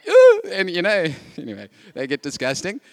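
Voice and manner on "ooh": high, loud squeal of disgust; loud high-pitched ooh of disgust. Retold or imitated. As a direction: recoil from the smell of the bags. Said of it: "And I'm like".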